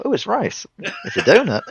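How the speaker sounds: high, bright fake cheerfulness; mock gaslighting. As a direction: sell the lie sweetly. Mocking the dub.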